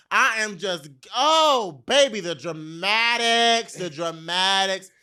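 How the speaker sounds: loud, high, drawn-out dramatics; theatrical exclaiming. Real, not acted. Storyteller playing up her run over cobblestones, theatrical. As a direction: stretch it out like a drama queen.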